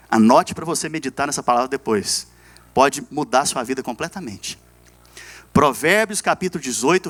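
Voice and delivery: ordinary talk, nothing marked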